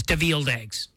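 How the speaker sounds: DeVilled eggs low and flat, stress on VILL, imitating the robot; deadpan robot DeVilled eggs; robot mispronunciation. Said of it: "as the robot says".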